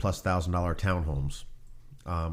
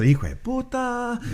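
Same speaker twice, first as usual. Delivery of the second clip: high, slow mock Spanish curse; hammed-up, drawn-out swear; muttered curse; slower than usual. Pablo cursing at being caught, high slow mock curse. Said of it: "you know".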